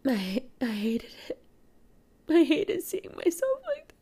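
broken whisper, barely voiced. Real, not acted. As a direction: whisper it through tears. Emotional confession, whispered.

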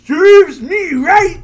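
gleeful confession, high and loud; gleeful high shout. Acted. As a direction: squeal it out high, loud and slow. Edwin cheerfully confesses.

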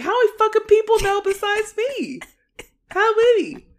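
high, loud exasperated outburst; mock furious yelling. Snake learning everyone knows but him, mock furious yelling. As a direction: explode in hammed-up outrage.

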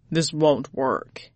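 slow, low grumbling tiger; a doubtful big cat. A narrator's reading.

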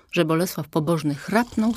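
ordinary delivery, nothing marked

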